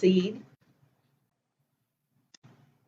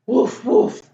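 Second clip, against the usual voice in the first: short imitation woofs; quick, playful mock barking; puppy bark; 3 semitones higher, 4 dB louder than usual. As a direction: bark like a little dog. Story reader barks as puppy Biscuit.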